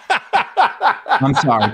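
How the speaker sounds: laughing through an apology; slow apology, cracking up. Real, not acted. Laughing after joke.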